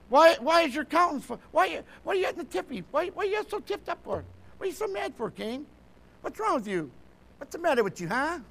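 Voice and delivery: high-pitched, playful scolding questions; the Lord chiding Cain. Acted. The Lord asking Cain why he is mad, high mock scolding. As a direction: pester him with puzzled, needling questions. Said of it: "And the Lord said in verse six".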